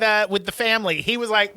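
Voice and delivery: ordinary talk, nothing marked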